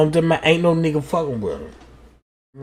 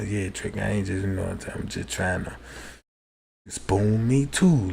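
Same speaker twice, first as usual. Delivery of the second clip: hushed whisper; 11 semitones lower, 10 dB quieter than usual. Retold or imitated. Cousin's plea voiced in the story.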